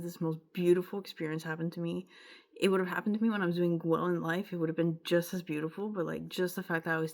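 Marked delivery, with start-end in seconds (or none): none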